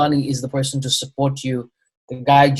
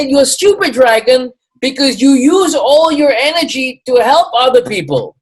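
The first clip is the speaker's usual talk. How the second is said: much higher, louder put-on Chinese accent; a teasing Chinese accent, pitched way up, stupid dragon slowed; bad Chinese accent; 10 semitones higher, 7 dB louder than usual. Acupuncturist scolding the proud dragon, high Chinese accent. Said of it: "a really bad version of his accent and his vo".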